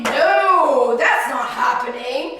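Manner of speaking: loud, drawn-out refusal; loud denial. Acted. Preacher voicing doubters of a revival, loud mock denial. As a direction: deny it loudly, stretching the words. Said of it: "then there would be".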